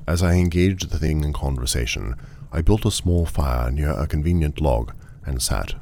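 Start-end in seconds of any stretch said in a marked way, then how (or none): none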